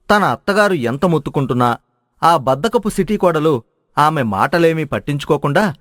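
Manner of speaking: usual speaking voice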